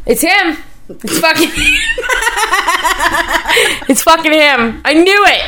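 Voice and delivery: high, laughing mock thrill; excited realization. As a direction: retell the kid's giddy discovery. Retold thrill at Santa's reply.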